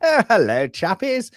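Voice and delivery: high-pitched, hammed-up chappie hello; Sandy's chappie greeting. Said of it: "Sandy's very prominent, loves the".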